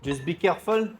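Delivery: ordinary talk, nothing marked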